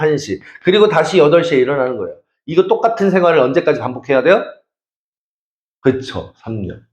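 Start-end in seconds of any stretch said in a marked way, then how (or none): none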